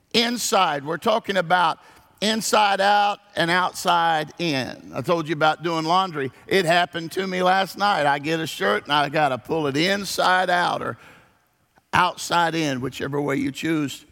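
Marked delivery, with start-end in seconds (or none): none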